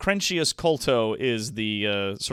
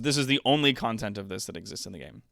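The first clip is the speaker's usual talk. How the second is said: ordinary delivery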